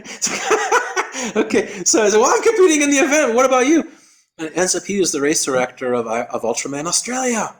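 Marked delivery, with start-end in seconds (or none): 2.2-3.9 high, loud, fast comeback; re-enacted comic indignation; exclaimed retort. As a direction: throw it back at a high pitch. Heated comic back-and-forth retort.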